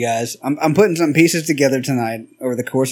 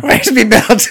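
put-on Irish accent; 7 semitones higher, slower than usual. Imitating McGregor, high and far.

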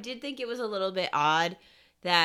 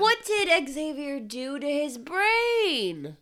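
high, slow, drawn-out wail; comically overwrought cry of disbelief; distraught wail; 8 semitones higher, slower than usual. Real, not acted. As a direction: wail the question in exasperation. Wailing over confusing backstory.

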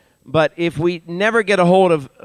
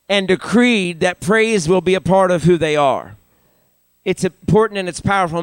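usual speaking voice

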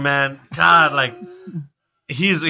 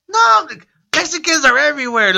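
high, louder mock exclamation; Paul Rodriguez; 14 semitones higher, 5 dB louder than usual. Famous comic joking with the sound guy, high-pitched imitation. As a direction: exclaim it high in a playful impersonation.

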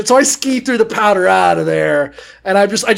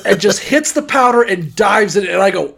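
ordinary delivery

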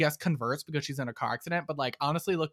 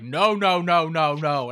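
mock emphatic refusal; 6 dB louder, much slower than usual. Congress voiced refusing.

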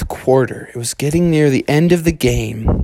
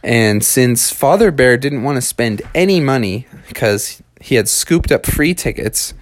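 ordinary delivery, nothing marked

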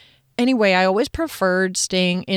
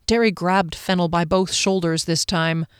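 plain reading voice

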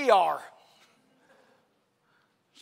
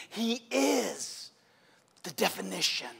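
ordinary delivery

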